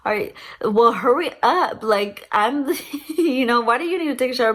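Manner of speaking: half-laughing push to hurry up; impatient urging. Retold or imitated. Wife urging husband to hurry up, impatient.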